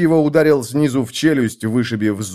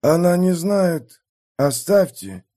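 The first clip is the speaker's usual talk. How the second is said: higher, slow, chilling voice; eerie wounded man; 6 semitones higher than usual. A narrator's reading. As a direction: speak slowly, as if half dead. Wounded man speaks with eerie slowness.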